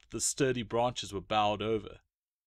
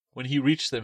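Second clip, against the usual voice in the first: plain reading voice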